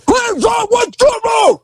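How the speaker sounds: high panicked shout of hands up; startled cop hollering at the prisoner. Retold or imitated. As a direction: yell it in alarm at the suspect. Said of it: "Starts hollering".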